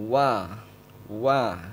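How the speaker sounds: "Wa" with rounded lips spreading to a smile; pronunciation demo. Shown as a demonstration. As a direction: demo lips pursed then smiling on "wa". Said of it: "bibir kita atau mulut kita monyongkan".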